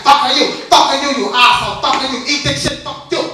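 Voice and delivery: high, angry mock Chinese accent; badly pronounced cursing in a mock Chinese accent. Mad restaurant owner cursing a customer, mock Chinese accent. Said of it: "they can't swear right".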